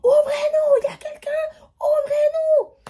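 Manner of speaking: high, loud crying shouts; imitated frantic banging yells; frantic shouting. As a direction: yell through the door in a panic.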